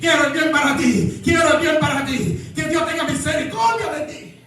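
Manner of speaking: high-pitched, driving sermon delivery; predicación enérgica. Acted. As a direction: preach it hard, pushing the pitch up. Preaches at full force. (prédica encendida)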